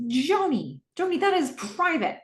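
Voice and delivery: high-pitched, protesting dad; mock indignant dad. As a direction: act out an embarrassed father objecting. Dad embarrassed that daughter heard video.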